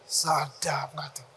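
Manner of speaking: soft whispered question; hushed teasing aside. Real, not acted. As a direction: lean in and whisper. Quiet aside to audience.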